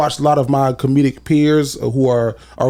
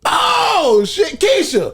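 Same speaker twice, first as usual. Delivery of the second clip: much higher, mock-surprised cry; mock sudden recognition; much slower than usual. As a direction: act out suddenly remembering Keisha. Suddenly remembering a name.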